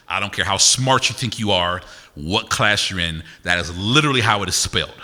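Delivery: usual speaking voice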